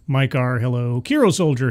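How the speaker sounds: ordinary talk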